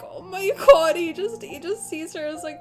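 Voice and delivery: choked-up gush at cuteness; overwhelmed mock sob. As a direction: overcome fangirl sob. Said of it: "I was like".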